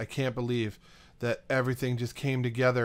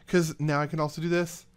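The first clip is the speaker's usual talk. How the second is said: ordinary talk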